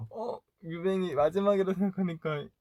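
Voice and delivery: high-pitched retold lament; mock sadness. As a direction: imitate the manager getting sentimental. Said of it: "이러면서 얘기를 하고 있었다고". (높은 톤으로 슬픈 척 흉내)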